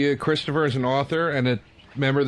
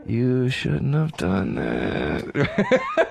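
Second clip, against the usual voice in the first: very slow, hammed-up threat; drawn-out mock menace breaking into laughter; menacing avenger; 3 semitones lower, much slower than usual. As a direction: drag out every word like a movie villain. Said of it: "To just go".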